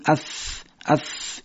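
unvoiced, blowing air over the teeth on اف; اف as a voiceless puff of breath; breathy th. Shown as a demonstration. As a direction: blow the ث out softly. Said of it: "فوت کردن در محل همین حرف".

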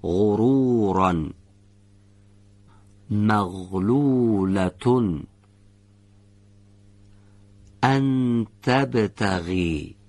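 غرورا and تبتغی drawn out slowly, with a voiced throat fricative on each غ; غرورا, مغلولتون and ان تبتغی read very slowly, carefully pronounced; slow Quranic recitation. Shown as a demonstration. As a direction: recite slowly and let every غ scrape in the throat. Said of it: "به بقیهٔ کلمات دقت کنید".

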